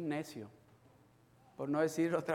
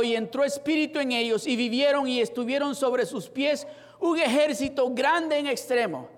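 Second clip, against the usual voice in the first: full-voiced sermon, higher and louder; preaching; 6 semitones higher, 8 dB louder than usual. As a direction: preach it out to the congregation. Preaches scripture and questions.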